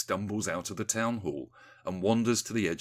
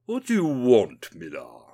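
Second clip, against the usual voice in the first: slow snarl, loud then dropping on the name; 3 semitones higher, 8 dB louder than usual. A narrator's reading. Rees-Mogg to the intruding Miller, snarling. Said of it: "he snarls".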